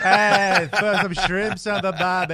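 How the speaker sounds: loud, high, drawn-out Aussie shout; Australian. Acted. Co-host playing to one Australian listener, shouted Aussie accent. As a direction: holler it in a hammed-up Australian accent. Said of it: "how about some Australian?".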